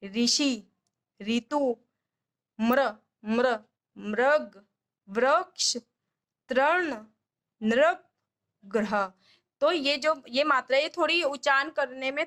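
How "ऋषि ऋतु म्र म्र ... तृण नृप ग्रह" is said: sounding out ऋ as री in ऋषि, ऋतु, मृग; ri matra words demo. Shown as a demonstration. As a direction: spell out म्र, मृग, वृक्ष, तृण, नृप slowly for a child. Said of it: "री की मात्रा वाले शब्द". (ऋ की मात्रा साफ़ बोलना)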